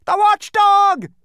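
high, slow, drawn-out cry of terror; terrified shout. A narrator's reading. Lethargerian shrieking warning about the watchdog, terrified. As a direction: scream the name in fright. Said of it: "shouted another".

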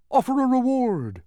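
high, loud humbug; eager high-pitched call; bright suggestion. A narrator's reading. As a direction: pipe up with another idea. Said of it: "offered the bug again".

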